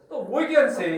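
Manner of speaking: ordinary delivery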